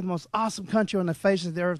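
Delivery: ordinary delivery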